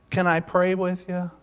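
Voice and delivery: a hint of a young camper's voice; ten-year-old camper. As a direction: gently imitate a boy offering comfort. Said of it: "now this is how he talked".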